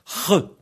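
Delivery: "Re" as a high, rasped khe; isolated guttural "Re", like kh; throat scraping. Shown as a demonstration. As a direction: scrape your throat to make "Re". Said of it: "en vous raclant la gorge".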